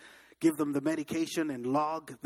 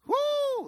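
whoop. Retold or imitated. Reaction to being fired.

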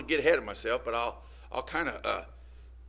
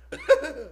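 short high laugh; 6 dB louder than usual. Real, not acted. Preacher laughs mid-point.

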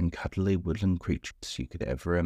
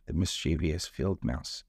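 plain reading voice

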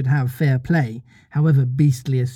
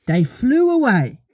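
high, lilting little-mother storyteller; childlike storytelling voice; Wendy telling a story; 10 semitones higher than usual. Acted. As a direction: pitch it up, telling children a tale. Wendy continues her story.

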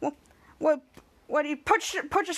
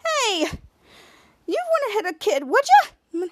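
mock innocent; 5 semitones higher, slower than usual. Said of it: "and Shadow's like".

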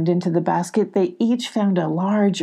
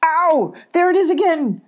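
high, louder panicked cries; frightened cry; 12 semitones higher, 4 dB louder than usual. Acted. Abby crying out that something is stealing her button. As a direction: cry out in fear. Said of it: "cried Abby".

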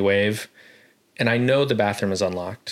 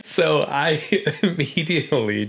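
said through real laughter, higher and slow; laughing; 7 semitones higher, slower than usual. Real, not acted. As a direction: laugh your way through the words. Laughing while answering.

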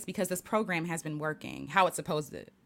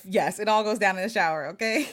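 laughing; 4 dB louder than usual. Real, not acted. Laughing confession about shower arguments.

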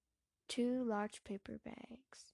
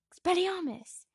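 loud high spell cast; shouted spell; 10 semitones higher, 11 dB louder than usual. Acted. Casting a disarming spell.

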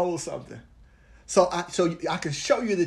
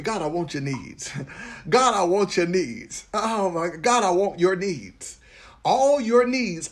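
leading a prayer; 3 semitones higher, 3 dB louder than usual. Acted. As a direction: pray it aloud for the room to follow. Leads congregation in prayer.

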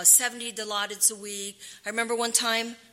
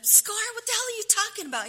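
high, fast disbelieving cry; retelling her own stunned reaction, pitched high; incredulous outburst; 13 semitones higher, 3 dB louder, faster than usual. Retold or imitated. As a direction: blurt it out in shocked disbelief. Her shocked reaction to kissed scar.

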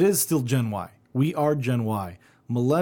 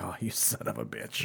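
muttered curse; 6 semitones lower, 12 dB quieter than usual. Retold or imitated. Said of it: "I heard my dad's voice echo".

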